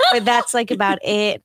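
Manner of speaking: normal talking voice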